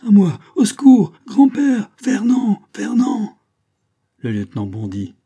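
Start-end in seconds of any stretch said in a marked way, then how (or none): 0.1-3.4 high, loud screams for rescue; cry for help. A narrator's reading. Captive girl calling grandfather and lover, desperate cry for help. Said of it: "un cri l'interrompit".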